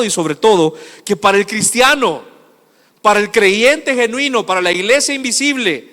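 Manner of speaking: rising, forceful pulpit declaration; emphatic preaching. Real, not acted. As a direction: build to a loud proclamation.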